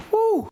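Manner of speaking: high, short "woo"; relieved whoo. Real, not acted. Preacher reacting to a long list, high whoo. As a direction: let out a quick high-pitched whoo.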